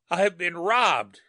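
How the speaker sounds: slow, high, croaking cry; harsh croak. A narrator's reading. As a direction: croak it out in shock. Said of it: "he croaked harshly".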